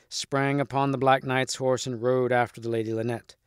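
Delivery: plain reading voice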